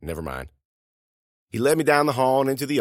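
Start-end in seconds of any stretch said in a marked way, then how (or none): 0.0-0.5 low, soft backing off; hasty retraction. Acted. Student takes back guess to vice principal, hasty retraction. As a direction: drop it quietly, pitch sinking.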